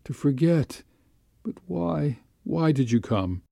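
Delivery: high, hushed lament that sinks; mildly tearful-sounding plea, falling away; anguished reproach. A narrator's reading. Anguished lover reproaches him.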